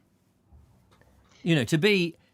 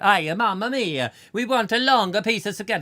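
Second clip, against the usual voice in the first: hammed-up Italian accent, louder; a cartoon Italian asking for more spaghetti; mock Italian accent; 5 semitones higher, 5 dB louder than usual. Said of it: "they were Italian".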